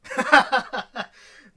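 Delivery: loud, high laugh; real burst of laughter. Real, not acted. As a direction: burst out laughing at a self-deprecating joke.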